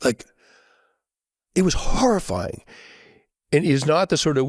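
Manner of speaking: usual speaking voice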